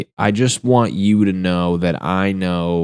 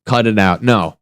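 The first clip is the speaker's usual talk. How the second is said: ordinary delivery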